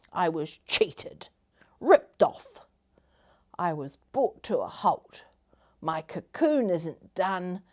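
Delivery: punchy, aggrieved complaint; griping. Acted. Caterpillar complaining to the butterfly neighbour, griping. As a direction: whine that it's all unfair. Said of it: "The other one griped".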